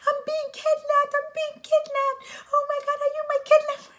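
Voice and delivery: very high, loud shrieking panic; over-the-top squealed alarm; screaming. Retold or imitated. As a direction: re-enact a hysterical scream for help. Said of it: "So I started screaming".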